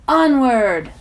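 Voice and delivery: bright, loud rallying call; cheerful command. A narrator's reading. Old lizard on her shoulder cheering the group onward. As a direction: call it out like leading a charge.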